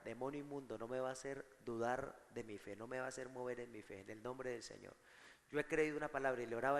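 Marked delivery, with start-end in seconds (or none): 0.0-4.9 low, soft retold rebuke; hushed re-enacted prayer against the demon. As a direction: murmur the rebuke with quiet firmness. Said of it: "yo reclamaba esa palabra".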